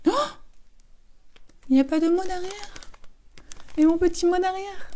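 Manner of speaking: hammed-up little sulky voice; mock disappointment. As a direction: fake being hurt, then drop it. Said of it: "Non, je rigole".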